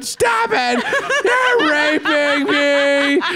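high, slow, hammed-up pleading scream through laughter; mock screaming victim. The actress in the scene, mock-screamed for the brother. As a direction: ham up a victim's cries while cracking up. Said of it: "you hear Jodie Foster going".

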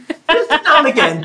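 laughing through the words; giggling while teasing. Real, not acted. Teasing co-host about accents.

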